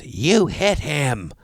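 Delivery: very slow, drawn-out mock accusation; imitated yelling accuser. Woman accusing the golfer of hitting someone, mock yell. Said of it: "She's yelling at me".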